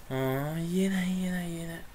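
breathy whispered mutter to himself; half-whispered giving up. Real, not acted. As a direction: drop to a whisper, almost resigned.

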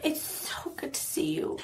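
soft whispered greeting; whispering. Acted. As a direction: breathe it out in a hush. Character greets shopkeeper softly.

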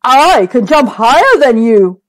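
lightly voiced boastful cow. A narrator's reading. Cow arguing with the dog. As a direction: brag like a cow sure of her jump. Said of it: "said the cow".

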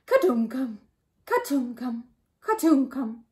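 rhythmic thudding nonsense noise; three even plopping beats; mud-piling sound. A narrator's reading. Lion scooping mud onto turtle, rhythmic thuds.

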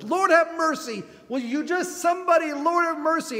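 high, loud desperate cry. Retold or imitated. Bartimaeus calling out to Jesus, desperate cry. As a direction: beg out loud for someone to hear. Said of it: "he's crying out over and over".

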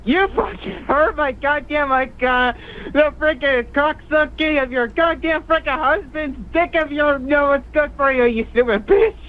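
high-pitched sputtering rage; real flustered indignation; angry retort. Real, not acted. Insulted caller hurls abuse back.

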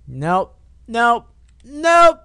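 escalating refusal. Real, not acted. Player backing away from a knife, rising alarmed refusal.

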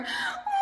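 put-on very high baby wail; shrill mock baby cry. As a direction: imitate a baby crying. Baby cries louder.

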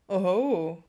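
loud teasing oho. A narrator's reading. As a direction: make a knowing oho. George teasing.

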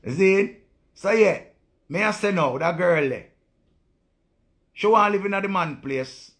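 worked-up loud rant; heated loud rant. Real, not acted. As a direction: rant like the point is obvious. Heated rant about girlfriend.

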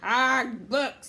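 loud, scornful pirate captain; exasperated pirate outburst; disgusted pirate. A narrator's reading. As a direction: spit out your disgust.

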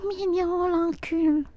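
put-on high-pitched voice, falling; playful high voice. Streamer joking with friends, playful high voice. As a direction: toss it out in a high mock voice. Squeaky mock exclamation while joking.

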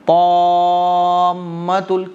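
"ताम्मतुल" with a long madd and doubled m held; stretched and joined. Shown as a demonstration. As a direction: hold the "ताम्मतुल" long, slowly. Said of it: "खींच के मिलाया जाएगा". ("ताम्मतुल" को खींचकर मिलाकर)

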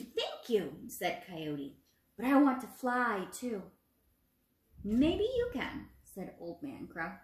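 put-on storybook narration for young children; expressive read-aloud for a classroom; reading a story aloud. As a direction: read it to the class like a picture book. Teacher reads story to class.

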